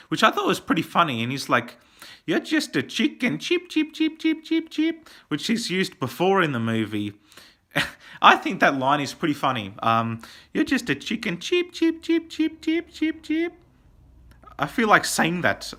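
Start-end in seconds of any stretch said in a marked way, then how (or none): 2.2-5.1 high-pitched mocking chick cheeps; chicken taunt. Reviewer imitates Johnny taunting in the fight, high chicken cheeping. 10.5-13.5 high-pitched mocking chick cheeps; chicken taunt. Reviewer imitates Johnny taunting in the fight, high chicken cheeping.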